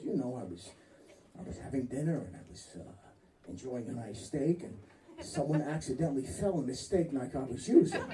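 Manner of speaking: lightly voiced prisoner; low innocent-sounding inmate. Inmate friend tells his alibi.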